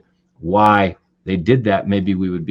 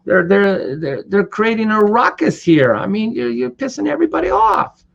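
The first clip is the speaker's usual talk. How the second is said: high, exasperated complaint to a stranger; mock scolding a careless father; high-pitched irritated demand; annoyed confrontation; 10 semitones higher than usual. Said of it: "and he says".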